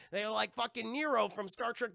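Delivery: ordinary talk, nothing marked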